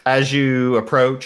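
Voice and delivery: ordinary talk, nothing marked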